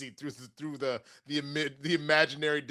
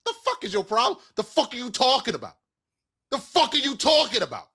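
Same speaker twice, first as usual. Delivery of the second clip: mock outraged; 7 semitones higher, 5 dB louder than usual. Real, not acted.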